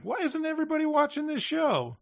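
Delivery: high mock disbelief at an ignored show; exasperated fan cry. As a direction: throw it up high like a baffled fan. Acted exasperated fan quote.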